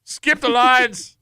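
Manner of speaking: hammed-up old-timey announcer voice; medieval voice. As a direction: read the ad like a medieval herald. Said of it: "now I will switch to my medieval voice".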